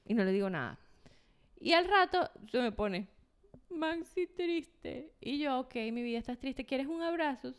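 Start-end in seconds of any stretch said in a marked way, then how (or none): 3.7-5.1 slow, soft, higher little sad voice; mock sad toddler, very slow and hushed. 5.6-7.5 tender comfort. Retold or imitated. Mother comforting sad toddler.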